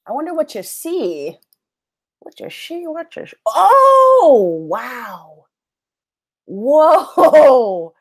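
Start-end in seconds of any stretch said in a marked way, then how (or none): 2.2-5.5 surprised. Real, not acted. Sees her screen share for the first time. 6.4-7.9 surprised. Real, not acted. Sees many copies of herself.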